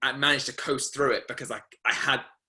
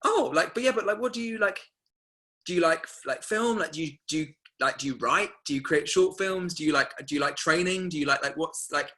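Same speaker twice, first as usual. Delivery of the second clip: higher, pushy questioning; imitating the agent; 6 semitones higher than usual. Agent pushing the student about her creative work, higher. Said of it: "And he was like".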